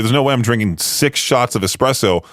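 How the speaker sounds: usual speaking voice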